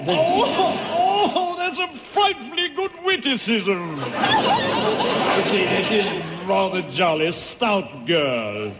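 very high, hammed-up English twit; English accent. [name] praises Tallulah's witticism, high silly English accent. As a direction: titter like a comic English gent. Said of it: "that's a wonderful English accent".

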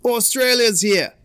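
loud, high Aussie trash talk; Australian accent. Acted. Australian surfer announcing himself to Hawaiians, loud Australian accent. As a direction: taunt them loudly in a broad Australian accent. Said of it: "How would an Australian sound talking shit".

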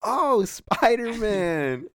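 high, drawn-out exaggerated delivery; slow, high mock flourish; Jose's little flourish. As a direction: stretch it out slowly in a hammed-up imitation. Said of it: "he has to do, like, the little".

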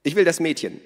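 hohe, laute Stimme einer lebhaften Frau; Minnas Stimme. A narrator's reading. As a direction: Minna ruft die Gäste fröhlich zum Essen. Narrator voices Minna. (hohe, laute Frauenstimme)

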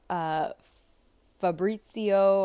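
normal talking voice

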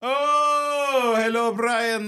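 mock Jamaican-ish accent, high and hammy; put-on accent. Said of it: "What was that?".

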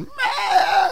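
very high, loud imitated squeal; mock fangirl squeal. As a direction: squeal like a starstruck fan, hammed up.

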